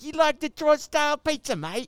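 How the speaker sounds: very high, loud mock Australian; Australian devil. Devil offers pizza to his mate, high mock Australian accent. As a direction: squeal it as an Aussie devil. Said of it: "The devil's down there going like".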